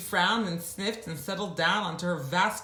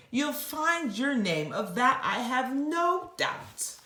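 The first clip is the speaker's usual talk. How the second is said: elephant; 8 semitones higher than usual. Acted. Elephant voice continues.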